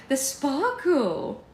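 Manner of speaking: high, loud, stretched-out relish; gushing mother. A narrator's reading. Mother praising Alex's act, high drawn-out gush.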